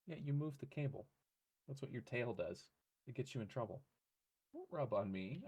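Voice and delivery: soft, quiet teasing to an animal; fond half-hearted telling-off; gentle pet scolding. Real, not acted. Owner softly telling off the pet rubbing on him.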